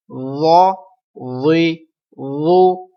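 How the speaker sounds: و، وی، وو each begun with a thick th as in "this"; slow, rounded, heavy و، وی، وو without whistle; soft emphatic drill. Shown as a demonstration. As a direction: model و، وی، وو full-mouthed and drawn out. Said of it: "سختی کی آواز نہیں آئے زو پر بھی".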